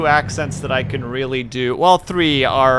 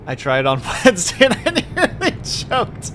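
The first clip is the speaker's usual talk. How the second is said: higher-pitched, breaking into laughter; laughing; 6 semitones higher than usual. Real, not acted. Telling of nearly choking on Wednesday, laughing. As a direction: talk through a laugh.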